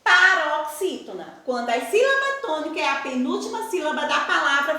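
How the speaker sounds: usual speaking voice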